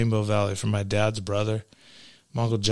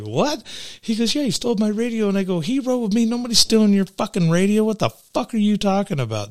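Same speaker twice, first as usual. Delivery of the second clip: incredulous; 10 semitones higher than usual. Retold or imitated. Retold exchange, raised pitch.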